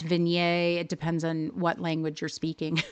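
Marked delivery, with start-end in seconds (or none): 0.0-0.8 vignette with a silent T, ending in -ay; alternative pronunciation. Shown as a demonstration. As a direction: say vignette as vin-YAY. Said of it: "no wrong way to say".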